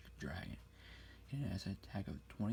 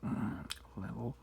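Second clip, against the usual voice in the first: whisper in left ear; slower than usual. Acted.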